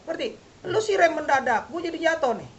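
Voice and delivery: blaming whine. Retold or imitated. Fallen biker blaming the other.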